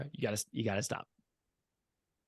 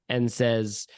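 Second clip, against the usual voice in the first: ordinary talk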